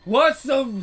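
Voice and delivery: loud, high, hammed-up Warhammer orc greeting. Explaining how Warhammer orcs talk, a loud high mock orc shout. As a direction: bellow it like a rowdy Warhammer orc.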